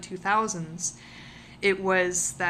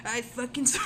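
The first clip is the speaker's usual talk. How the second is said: laughing; 4 semitones higher, faster than usual. Acted. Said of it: "I'm in my sixties".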